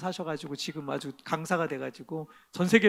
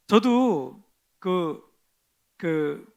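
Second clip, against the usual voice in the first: ordinary delivery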